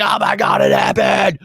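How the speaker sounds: sudden shout of disbelief, pitch jumping up; excited outburst. Retold or imitated. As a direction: blurt it out like the news just hit you. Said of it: "I was like".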